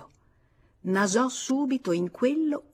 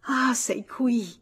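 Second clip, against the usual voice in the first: drawn-out gasp of relief into a loud reproach; high, overwrought outburst; quivering agitation; 6 semitones higher, 7 dB louder than usual. A narrator's reading. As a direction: cry out, overcome with emotion. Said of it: "balbettò fremente".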